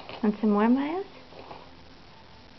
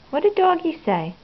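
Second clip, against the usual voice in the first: a higher, brighter sing-song question; 8 semitones higher, 4 dB louder than usual. Real, not acted. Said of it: "asked again higher".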